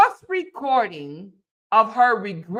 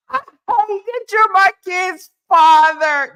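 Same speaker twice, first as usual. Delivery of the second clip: louder, higher mock sobbing rant; imitating her crying; 6 semitones higher, 7 dB louder than usual. Imitating Cora crying to him on the recording, loud mock sobbing. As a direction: wail it out like a hammed-up crying fit. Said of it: "She said".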